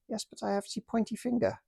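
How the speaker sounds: put-on high, lightly accented stubborn officer; German toy officer defending his map, in a high stubborn voice. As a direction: insist on the map, pitched high. German toy soldier answers.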